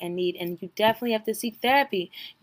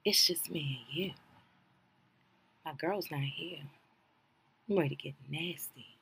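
softer, lower seductive take, teasing; mock sultry voice; 6 semitones lower, 12 dB quieter than usual. Recapping the show, imitating her seductive line softly. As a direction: breathe it low like a mock temptress. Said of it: "And she talking like this".

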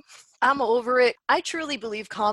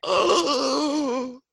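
mock shocked gasp; 3 semitones higher, 6 dB louder than usual. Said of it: "And I'm like".

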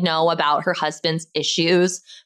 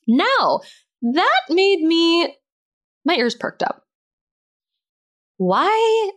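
surprised; 11 semitones higher, slower than usual. Real, not acted. Gossip twist catches her ear.

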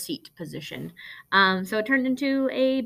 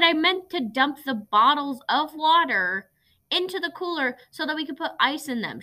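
lightly voiced exasperated mom, higher; imitating mom explaining impatiently; a hint of an annoyed mother, pitched up; upset mom; 8 semitones higher, 4 dB louder than usual. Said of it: "she got really upset with me and was like".

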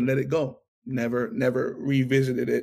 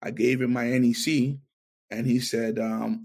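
normal talking voice

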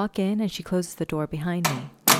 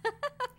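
short high laugh, pleased; laugh, pleased with yourself; 8 dB quieter than usual. Acted. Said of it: "She laughs".

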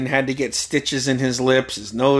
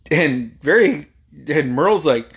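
usual speaking voice

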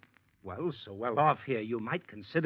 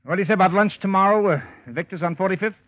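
eager; 6 semitones higher, 10 dB louder than usual. Acted. Shelton eagerly sets up the lunch.

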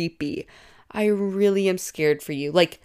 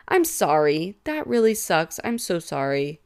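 ordinary talk

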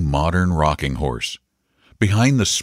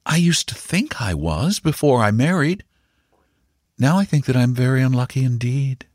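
higher voice, understated complaint; resigned mother; 7 semitones higher than usual. A narrator's reading. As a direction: answer the child with a touch of resentment.

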